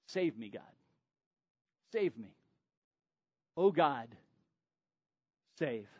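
low, soft, mock-pitiful begging; mock pleading. Elijah's unspoken plea to God, mock-pitiful and low. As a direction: whimper a half-hearted plea to God. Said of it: "passive-aggressively asking".

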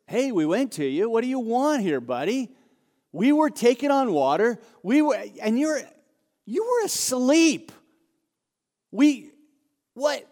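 hammed-up exasperation, pitch leaping up; indignant disciple. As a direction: protest in disbelief, sputtering to a halt. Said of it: "What if you're a disciple?".

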